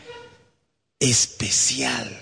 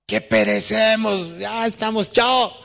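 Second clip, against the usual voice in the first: desperate cry; 10 semitones higher, slower than usual. Acted. Disciples crying for help.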